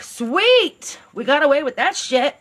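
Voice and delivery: high, smug mock cheer, imitating Carla and Paul; gleeful villains. Carla and Paul gloating after the death, mock-celebrating. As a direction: sneer it like you just got away with it. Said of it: "they're like".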